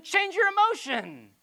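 high, loud, slow mock order; mock bossy demand. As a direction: bark it like an impatient parent. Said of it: "You're just saying".

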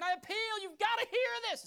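high, loud impassioned plea; urgent appeal. Acted. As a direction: shout it like they must hear. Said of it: "He's saying".

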